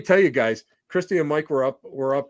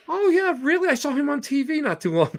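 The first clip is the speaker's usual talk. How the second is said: much higher, eager excitement; starstruck guard; 11 semitones higher than usual. Retold or imitated. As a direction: act the guard thrilled to recognize the name. Border guard recognizes TV name.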